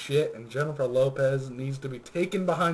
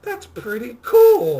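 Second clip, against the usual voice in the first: mock impressed; 9 semitones higher, 9 dB louder, slower than usual. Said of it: "you're like".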